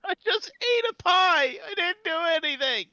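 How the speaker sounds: high, loud tearful wail; crying voice. Acted. Fairy protests innocence to Marianne, crying. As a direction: whine a protest as if near tears. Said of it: "fairy sounds like he's crying".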